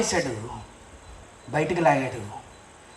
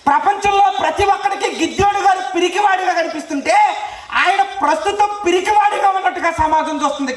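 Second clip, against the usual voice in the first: fiery preaching; 9 semitones higher than usual. Acted. Preacher marvelling to the crowd at Peter's tears, fiery. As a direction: proclaim the wonder with full force.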